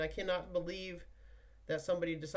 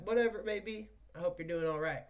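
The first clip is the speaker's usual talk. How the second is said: ordinary talk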